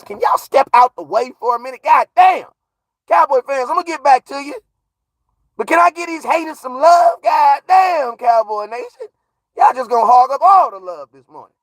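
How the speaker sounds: hyped shout. Real, not acted. Hyped calling out to fans.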